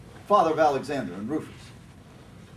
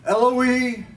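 high, loud anguished cry, slow on the last words; Jesus cries out from the cross, loud and anguished; 9 semitones higher, 6 dB louder, slower than usual. A narrator's reading. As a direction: cry out to God in agony. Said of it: "cried out in a loud voice".

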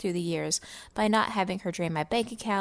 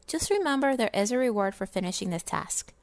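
ordinary delivery

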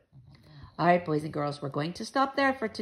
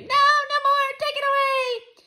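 frightened scream; 5 dB louder than usual. A narrator's reading. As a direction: shriek to make it go away. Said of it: "Sam started to scream".

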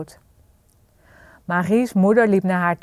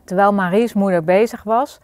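ordinary delivery, nothing marked

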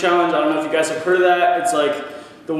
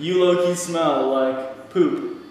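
slow and exaggerated mouthing; 3 dB quieter, much slower than usual. Shown as a demonstration. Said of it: "Again, again".

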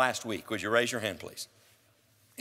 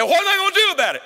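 much higher and louder, fast, overwrought question; mock worried listener; 14 semitones higher, 8 dB louder, faster than usual. Preacher voices a troubled listener asking what to do, fast high cry. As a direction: blurt it like a panicked listener.

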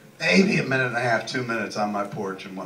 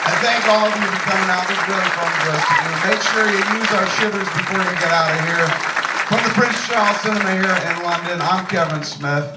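show sign-off; 11 semitones higher, 9 dB louder than usual. Acted.

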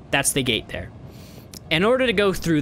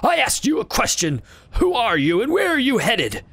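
put-on old beggar voice; 8 semitones higher than usual. Beggar demanding answers.

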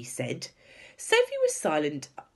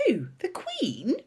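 high, shocked question rising at the end; appalled, pitched-up query; aghast; 10 semitones higher, slower than usual. A narrator's reading. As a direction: ask it in horrified disbelief. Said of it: "Sophie cried aghast".

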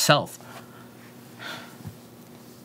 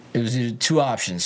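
usual speaking voice

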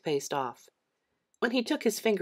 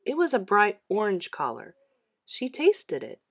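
usual speaking voice

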